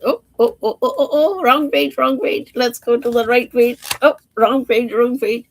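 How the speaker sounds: fumbling for the right page; flustered. Real, not acted. Turning to the wrong page.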